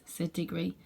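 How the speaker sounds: ordinary delivery, nothing marked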